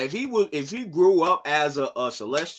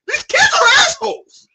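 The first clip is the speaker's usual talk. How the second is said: exasperated scream; 7 dB louder than usual. Real, not acted. Ranting about the manga kids.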